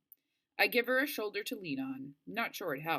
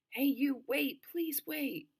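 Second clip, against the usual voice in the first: high, slow pleading call; 10 semitones higher, 4 dB louder, slower than usual. Acted. Girl in the woods begging the Jeep driver to wait, high pleading call. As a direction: beg the driver to stop, stretched out.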